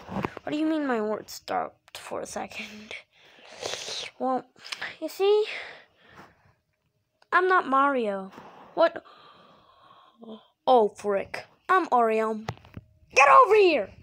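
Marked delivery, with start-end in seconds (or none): 0.1-2.9 whispering. Acted. Whispered defensive question. 13.1-13.9 loud menacing yell; angry shout. Acted. Attacker grabbing at victim.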